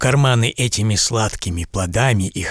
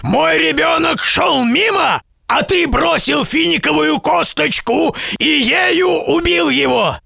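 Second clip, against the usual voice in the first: grieving furious giant. A narrator's reading. Giant telling how his child died, high angry shouting. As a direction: shout out the son's death. Giant explains son's death.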